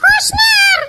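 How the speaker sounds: put-on high, shouting young woman's voice, whining; girl on phone. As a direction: squeal like an upset girl on the phone. Girlfriend Moyna answers phone.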